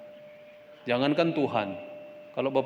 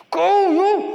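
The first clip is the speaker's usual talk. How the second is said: bentakan ayah; 11 dB louder than usual. Acted. Ayah memanggil anak untuk dipukul.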